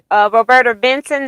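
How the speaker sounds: ordinary talk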